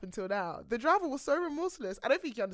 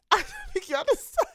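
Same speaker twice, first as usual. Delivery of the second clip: higher, laughing aside; laughing disbelief; 6 dB louder than usual. Real, not acted. Laughing disbelief at Uber story.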